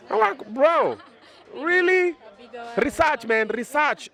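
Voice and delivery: high-pitched, incredulous protest; mock indignation. Real, not acted. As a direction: act scandalised by the question, pitch shooting up. Mock-offended at being asked about TV.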